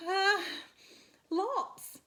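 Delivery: high, loud breezy mum voice; high loud careless answer; spider mum. Acted. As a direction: toss it off like a busy mother spider. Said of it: "said Mum".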